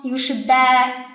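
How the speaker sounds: ba held long; long syllable. Shown as a demonstration. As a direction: draw out the vowel in ba. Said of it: "这个有两个长音节".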